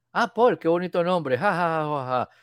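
high-pitched compliment with a put-on laugh; mock an over-polite reaction to a name; awkward flattery. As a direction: gush lightly, then fake a chuckle. Mimics awkward small talk with star.

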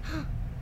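a small scared intake of breath; soft retold gasp. Girl recalling monster fears, soft gasp. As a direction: gasp quietly in fear. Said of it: "and I'm like".

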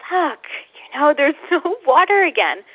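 mock exasperation at the empty jug; frustrated. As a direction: let the frustration out, half laughing. Acting out her frustrated self.

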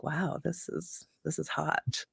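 soft, slow breathy sultry voice. Shown as a demonstration. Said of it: "becomes a little bit more breathy".

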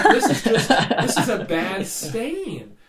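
high, loud, slow exasperated laugh through the words; laughing outburst. Retold or imitated. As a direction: blurt it out laughing in disbelief. Retold reaction to thin paint.

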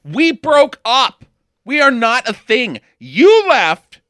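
loud, high, furious dumping; mock breakup fight, loud and high; angry breakup. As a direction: snap it at the ex like you mean it. Acted breakup quarrel scene.

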